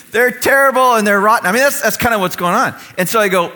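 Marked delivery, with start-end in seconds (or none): 0.1-1.5 loud, raised, hammed-up condemnation; emphatic mock trashing. Mock interviewee trashing the other team, loud and blunt. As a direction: pile on the insults with mock force.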